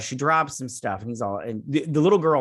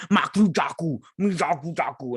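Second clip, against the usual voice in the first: weird alien gibberish; 4 semitones higher than usual. Retold or imitated. Alien trying to sound weird to the crew, mock gibberish. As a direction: make up strange alien words in a put-on voice. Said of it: "He's, like, trying to be all... weird".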